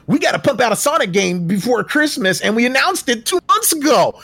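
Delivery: high, hammed-up studio panic; mock panicked developer. Host parodies developers rushing a game for Christmas, mock frantic. Mocking a rushed studio's reasoning.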